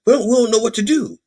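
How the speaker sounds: high, quick mock panic; hammed-up helpless fluster; flustered helplessness. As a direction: plead that you're at a loss. Flustered Republicans answering angry constituents.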